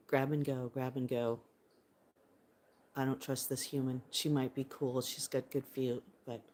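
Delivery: low, soft voice of a suspicious bird; wary bird. Pretend. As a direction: think aloud as a distrustful little bird, keeping it low. Voices the wary bird's thoughts.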